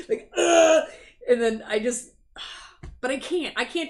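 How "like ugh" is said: loud, high laughing groan of frustration; loud laughing ugh, fed up on a viewer's behalf; frustrated ugh. Real, not acted. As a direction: let out a big laughing ugh.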